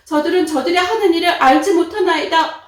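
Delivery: loud, earnest prayer; Jesus praying for his tormentors, loud and earnest. Acted. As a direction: cry out a plea to God. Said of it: "하나님께 이렇게 기도하는".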